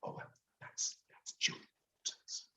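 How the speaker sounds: barely voiced whisper; mouthed. A narrator's reading. Narrator answering the cleaner on a quiet set, whispering. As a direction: mouth the words with almost no sound. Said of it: "I mouth back".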